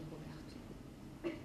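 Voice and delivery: whispered name. Acted. Mother breathes son's name.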